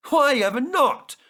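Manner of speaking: high, loud surprised question; astonished question. A narrator's reading. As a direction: ask in disbelief. Hatt surprised at Gordon.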